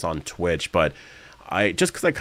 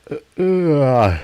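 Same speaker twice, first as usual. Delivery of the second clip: long yawn through the words; 8 semitones higher, 7 dB louder, slower than usual. Real, not acted. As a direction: drag it out in a big yawn. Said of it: "I'm yawning".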